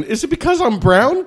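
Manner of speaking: high, light mock-offended question. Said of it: "I heard you slipping".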